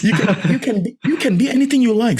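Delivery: high, giggling delivery; laughing through it. Real, not acted. Speaker laughing while repeating his point to hosts. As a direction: let the laugh break into the line.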